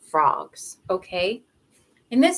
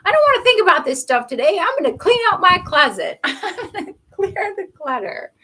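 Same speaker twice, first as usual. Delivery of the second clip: mock excited self; 7 semitones higher, 7 dB louder than usual. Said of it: "Or this could just be you".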